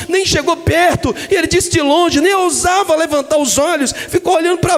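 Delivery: full-force sermon climax; preaching at full force. Acted. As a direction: preach it hard to the crowd.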